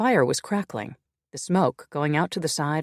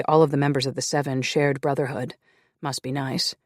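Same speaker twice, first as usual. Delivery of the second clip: ordinary delivery, nothing marked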